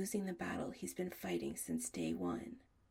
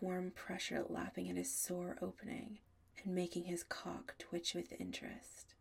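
ordinary delivery

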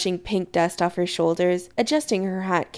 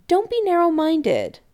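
higher-pitched mild scolding; chiding; 9 semitones higher than usual. A narrator's reading. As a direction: scold him lightly, pitch falling at the end. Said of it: "Kim chides".

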